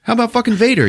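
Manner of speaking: shrill defender hamming it up; mock outraged fan. Friend imitating a fan defending the Jedi, squealing high. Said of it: "She's like".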